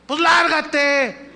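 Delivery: slow, high, loud snapped order; angry dismissal. Acted. Husband to packing wife, slow loud angry order. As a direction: throw her out in a hammed-up fit of temper.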